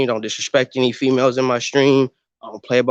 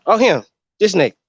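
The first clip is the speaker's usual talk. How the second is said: imitating locals; 4 dB louder, faster than usual. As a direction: act out locals spotting him. Voicing locals recognizing him.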